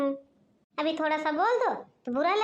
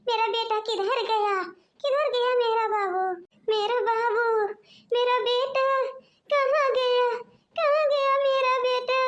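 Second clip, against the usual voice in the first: mother wailing for her missing son, frantic and rising; panicked wail; 7 semitones higher than usual. Acted. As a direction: call out for your baby in rising panic. Mother finds baby missing.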